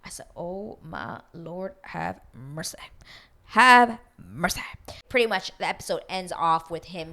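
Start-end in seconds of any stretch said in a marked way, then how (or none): 0.4-2.8 slow, soft mock prayer; hushed dismay. Viewer reacting to his confession, hushed dismay. 3.5-4.6 slow, loud mock plea; emphatic dismay. Viewer reacting to his confession, loud emphatic dismay. Repeats her reaction, much louder.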